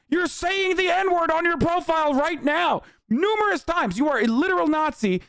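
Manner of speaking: high-pitched exasperated outburst; indignant rant. Acted. Streamer calling out a troll's fake shock, indignant rant. Angry rant at hypocrite account.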